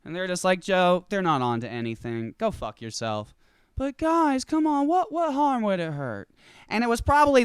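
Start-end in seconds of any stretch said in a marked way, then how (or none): 0.6-3.3 lower, flat brush-off; dismissive colleague voice. Acted. 3.8-6.3 higher, earnest stoner pleading; pleading Joe voice. Acted. Joe begging again.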